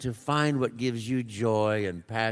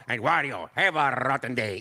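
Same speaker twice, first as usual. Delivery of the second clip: Wario voice. Acted. Wario voice at fan event.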